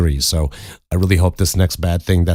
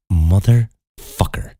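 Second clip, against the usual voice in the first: slow, stretched-out exasperated swear; drawn-out curse; much slower than usual. Retold or imitated. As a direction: drag the curse out in disbelief. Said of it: "And I went".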